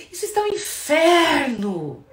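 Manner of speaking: loud, slow accusing cry; blaming outburst. Shown as a demonstration. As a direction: hurl it like a complaint in a fight. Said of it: "O inferno eu generalizo".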